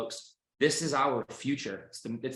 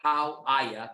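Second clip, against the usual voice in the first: put-on Boston accent; 4 semitones higher, much slower than usual. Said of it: "because I like to make fun of the fact that I'm from Boston".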